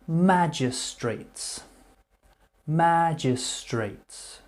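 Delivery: "Magistrates" said slowly and high, MA-jis-trates; slow model word. Shown as a demonstration. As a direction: stretch out "Magistrates" for learners. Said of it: "a magistrate is somebody".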